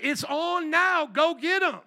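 slow, high, loud rallying cry; battle cry. Retold or imitated. David rallying the tribes of Israel, loud battle cry. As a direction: roar it to the troops. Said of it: "lifted it up ... and said".

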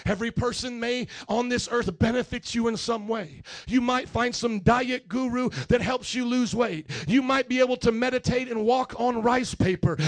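ordinary delivery

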